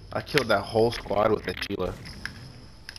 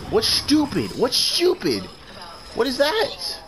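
"stupid" as "stoopid", higher voice; hammed-up British, T's softened; mock British, high and teasing; mock British accent; 13 semitones higher, 3 dB louder than usual. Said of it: "they don't pronounce their T's".

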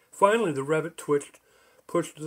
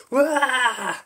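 high, loud yelp of alarm; startled yelp; 10 semitones higher, 6 dB louder than usual. Acted. Bridgekeeper launched skyward by spring shoes, high loud yelp. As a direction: yelp as you're flung into the air. Bridgekeeper suddenly flung into air.

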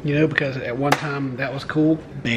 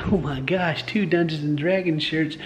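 usual speaking voice

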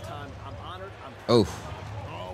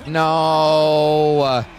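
long, loud, raised "no"; dismayed cry; 5 semitones higher, 6 dB louder than usual. Real, not acted. Gamer watching the team's chance slip, dismayed cry. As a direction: groan it out loud and drawn out.

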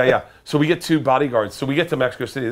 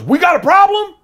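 tough guy challenge; 10 dB louder than usual. Retold or imitated. Angry truck driver confronts them.

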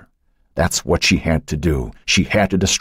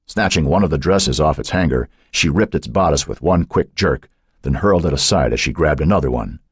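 ordinary delivery, nothing marked

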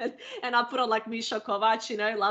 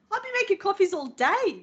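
exasperated complaint; 9 semitones higher than usual. Retold or imitated. Complaining after outburst.

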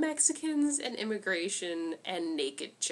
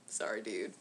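low, soft, flat tough-guy mumble; deadpan Machete; 10 semitones lower, 8 dB quieter than usual. Retold or imitated. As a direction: shrug it off like an unbothered action hero. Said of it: "He's like".

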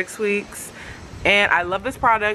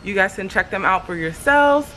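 ordinary talk, nothing marked

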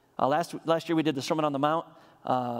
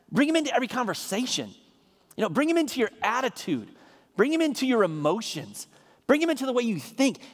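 fervent preaching; 4 semitones higher than usual. Acted. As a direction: pitch climbs high as he presses each point home. Preacher builds to passion.

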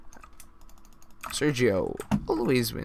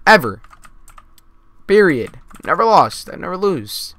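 loud, high-pitched gamer bragging; screaming boast; 5 semitones higher, 8 dB louder than usual. Real, not acted. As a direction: shout it out with big peaks on each claim. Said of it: "That's why I was screaming that".

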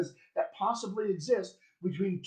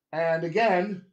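usual speaking voice

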